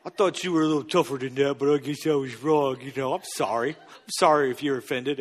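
put-on low, soft, patronising coo as if to a small child; condescending fake apology. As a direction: talk down to them like a little buddy, chuckling. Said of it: "what you're actually doing is being like".

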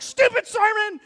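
hammed-up angry outburst at the sermon; frustrated shout. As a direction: yell it in frustration. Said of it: "I said that out loud".

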